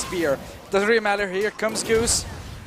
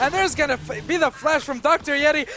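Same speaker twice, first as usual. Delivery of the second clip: excited; 7 semitones higher than usual. Real, not acted. Casting a dive.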